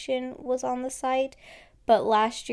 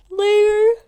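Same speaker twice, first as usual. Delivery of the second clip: word stretched by a yawn; 11 semitones higher, 11 dB louder than usual. Real, not acted. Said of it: "Sorry for the yawning".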